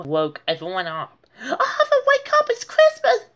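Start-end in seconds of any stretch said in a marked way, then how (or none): 1.4-3.3 put-on very high, loud thrilled child; excited cry. A narrator's reading. D W waking the family on Christmas. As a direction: shriek it with Christmas joy. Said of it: "she cried".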